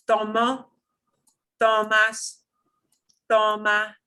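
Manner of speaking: Tomo, tomas, toma, each with a punched TO, held level; stress on first syllable. Shown as a demonstration. Teacher drilling second to last syllable, first-syllable stress on Tomo, tomas, toma. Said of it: "it's second to last syllable".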